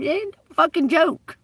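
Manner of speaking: mock retort. Advised retort, high pitch.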